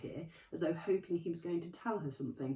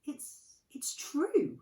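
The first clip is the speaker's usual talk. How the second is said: faltering; 10 semitones higher, 5 dB louder, much slower than usual. A narrator's reading. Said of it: "faltered Professor McGonagall".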